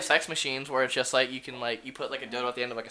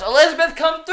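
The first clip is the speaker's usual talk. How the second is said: high, rising holler of approval; big whooping shout-out; hyped cheer; 9 semitones higher, 4 dB louder than usual. Real, not acted. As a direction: call it out like hyping a favorite. Cheering on a favorite candidate.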